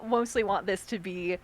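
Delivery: ordinary delivery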